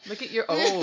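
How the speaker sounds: ordinary talk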